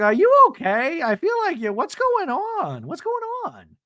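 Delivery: high, loud, stretched-out mock concern; exaggerated worried questioning; Gina. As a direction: fuss loudly in a high put-on voice. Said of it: "And Gina's like".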